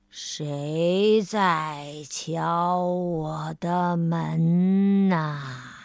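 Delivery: low, very slow drowsy call downstairs; sleepy slow voice. Acted. Mr. Slow calling downstairs from bed, low and very slow. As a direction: stretch every word as if half asleep. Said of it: "他冲着楼下问道".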